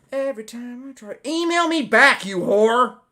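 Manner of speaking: sudden high, loud outburst; frustrated shriek at the screen; exasperated yell. Real, not acted. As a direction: snap at the unanswered email. Angry outburst at unanswered email.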